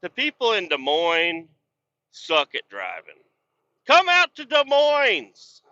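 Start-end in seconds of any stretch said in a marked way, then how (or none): 3.9-5.6 slow, high, loud sarcastic holler; high-pitched mocking call; mock invitation. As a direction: stretch it out like a sarcastic tourism pitch. Mock invitation after bad driving.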